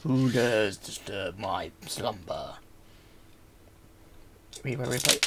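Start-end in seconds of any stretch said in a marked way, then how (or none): 0.0-2.5 slow, low, menacing undead ruler; ancient rasping undead king. Pretend. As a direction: drag it out like a dread king waking. Booming voice of woken Dalek Emperor.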